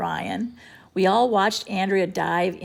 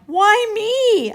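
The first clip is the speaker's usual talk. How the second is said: indignant protest; 13 semitones higher, 9 dB louder than usual. A narrator's reading. Boys arguing.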